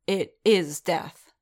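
cold anger. Acted. Din answering Kryze honestly, in cold anger. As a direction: let cold anger seep through. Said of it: "Anger broke open, cold and dangerous".

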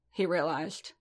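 plain reading voice

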